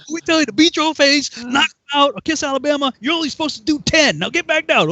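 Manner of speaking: high, forceful mock scolding; exasperated sergeant. Sergeant stops recruit after fifty, mock scolding. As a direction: rattle off the correction like a sergeant.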